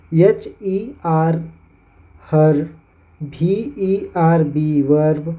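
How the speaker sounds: very slow spelling, then हर, verb with 'ar'; slow spelling demo. Shown as a demonstration. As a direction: drag each letter for learners. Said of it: "उनका उच्चारण अर से होता है". (बहुत धीमे हर और verb)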